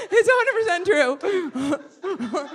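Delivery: high laughing insistence. Real, not acted. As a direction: swear it through a laugh.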